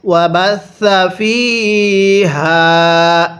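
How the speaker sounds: ওয়াবাসা ফিহা drawn out very slowly, each syllable held; slow Quran recitation. Shown as a demonstration. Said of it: "তাহলে কিভাবে পড়ব?". (ধীরে ধীরে কুরআন তিলাওয়াতের সুরে)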